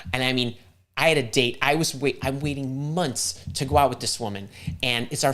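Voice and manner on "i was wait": ordinary delivery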